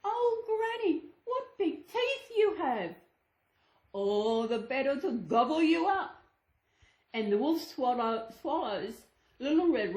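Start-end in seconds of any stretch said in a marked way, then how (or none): 0.0-3.0 put-on higher, wide-eyed little girl; wondering girl. Girl to disguised wolf, high and wondering. 3.9-6.2 lightly voiced greedy wolf; hungry wolf. Wolf about to gobble the girl, lightly greedy.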